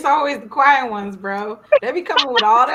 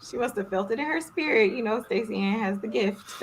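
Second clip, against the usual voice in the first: ordinary delivery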